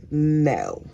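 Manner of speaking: low, flat-out curt no. Retold or imitated. Kay curtly answering Lori's question, low and dismissive. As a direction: shut her down with one low word. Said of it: "Kay was like".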